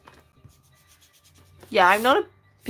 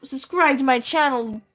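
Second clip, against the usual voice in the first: mock YouTuber hype voice, louder; hammed-up influencer intro; influencer accent; 3 semitones higher, 4 dB louder, much slower than usual. Streamer imitating an influencer accent, hyped. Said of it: "is it like the".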